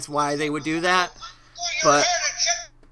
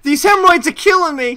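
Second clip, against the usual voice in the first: hammy ad sufferer; 8 semitones higher, 6 dB louder than usual. Acted. Fake ad sufferer complaining.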